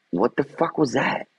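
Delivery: sudden startled what-the; startled outburst. Real, not acted. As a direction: caught off guard, blurting it out. Startled by something on stream.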